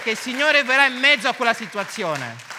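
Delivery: loud, high interpreter matching the preacher; fervent sermon voice; impassioned preaching. Real, not acted. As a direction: proclaim it at full force. Interpreter preaching at full force.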